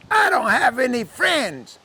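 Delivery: put-on high, slow, tearful-child act; wailing little girl. Lonely girl answering the cow, high slow wail.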